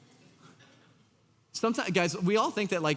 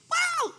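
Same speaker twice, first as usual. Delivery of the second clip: spirit shriek; high mock shriek. The spirit within the boy, a high shriek. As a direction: squeal a high demonic "wow".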